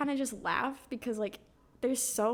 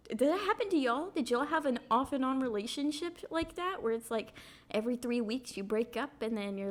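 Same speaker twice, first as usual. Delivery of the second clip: normal talking voice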